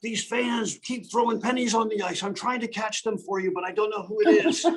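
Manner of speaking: high-pitched imitation of a heavy French accent; hammed-up French-accented coach, pitched well up. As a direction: put on a thick French accent, high and pleading. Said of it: "in his heavy French accent".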